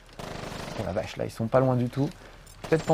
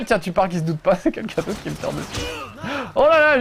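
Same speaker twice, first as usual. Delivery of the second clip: ordinary talk, nothing marked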